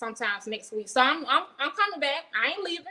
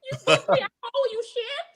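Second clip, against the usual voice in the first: indignant rant; 9 semitones higher, 8 dB louder than usual. Retold or imitated. Ranting at promoters wanting refunds.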